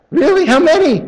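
high, loud eager questions; thrilled to hear about points; excited. Retold or imitated. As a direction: jump at the good news. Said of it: "I get all excited".

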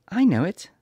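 put-on high little girl voice; worried girl asking. A narrator's reading. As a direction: plead as young Dorothy. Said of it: "returned Dorothy".

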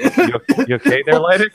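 high laughing concern. Real, not acted. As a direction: ask it through laughter. Laughing at friend's reading.

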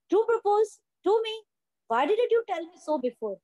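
high, slow rising disbelief; "To" lifted high and drawn out in shock; shocked surprise. Acted. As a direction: let the voice climb in astonishment. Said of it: "surprise होके पूछती है".

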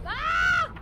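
sudden high terrified scream; real scream. Real, not acted. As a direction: shriek in real fright. Jumpscare by monster.

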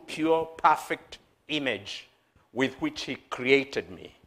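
ordinary talk

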